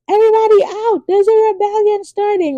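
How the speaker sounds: high, urgent call to clear the room; urgent warning. Retold or imitated. Messenger warning everyone to get out, high urgent call. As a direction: sound the alarm.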